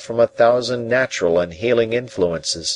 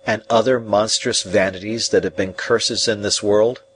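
usual speaking voice

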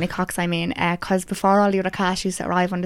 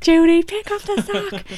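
high, drawn-out mock command; slow it right down, pitched far up; Phoebe; 13 semitones higher, 4 dB louder, slower than usual. Echoing a friend's Friends quote, high hammed-up impression. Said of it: "I love when Phoebe says".

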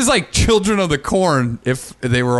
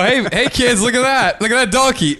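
tourist parent; 8 semitones higher than usual. Retold or imitated. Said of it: "You go".

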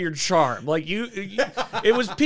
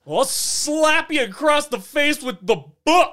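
mock threat; 10 semitones higher than usual. Playful threat.

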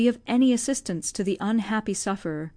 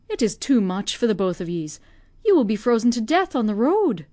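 put-on lamenting old Irish nursemaid, raised and heavy; old Irish servant. Old servant grieving for the deserted family, Irish voice. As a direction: grieve aloud for the starving family, in a broad Irish lilt.

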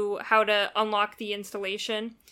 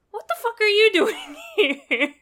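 high, loud incredulous cry with laughter; mock incredulity; 11 semitones higher, 8 dB louder than usual. Player as Arbiter spotting Guilty Spark, mock incredulity. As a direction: exclaim in comic disbelief, pitched way up. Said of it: "you're like".

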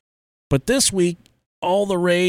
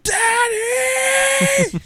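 high drawn-out mock scream; imitated mad child. Three-year-old smeared by dad as a prank, imitated mad screech. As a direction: screech it like an outraged toddler. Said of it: "She was so mad".

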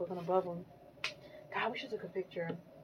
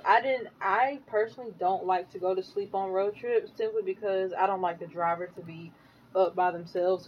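usual speaking voice

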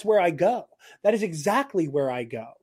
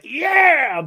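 long, higher, louder whooped yeah; mock excited cheer; 11 semitones higher, 5 dB louder than usual. Promising a friend joy rather than crying, excited mock cheer. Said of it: "me being like".

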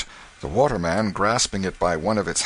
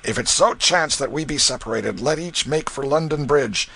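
urgent instructions; 5 semitones higher, 3 dB louder than usual. Acted. Canty instructs family in flight.

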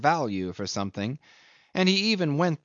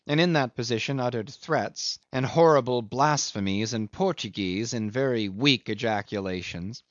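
usual speaking voice

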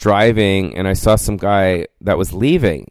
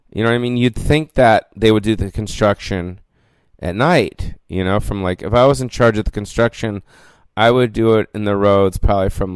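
ordinary talk

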